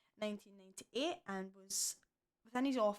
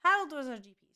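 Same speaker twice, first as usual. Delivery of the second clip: emphatic reveal; 5 semitones higher, 10 dB louder, faster than usual. Real, not acted. Confirming the reveal.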